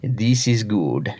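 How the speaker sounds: unhurried serene catchphrase; calm friend. Retold or imitated. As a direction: say it slowly and contentedly.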